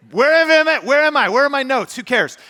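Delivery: high and loud, laughing at himself for losing his notes; a speaker on stage blurts out that he has lost his notes, flustered and loud; flustered outburst. Real, not acted.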